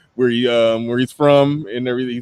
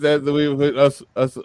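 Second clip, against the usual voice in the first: ordinary talk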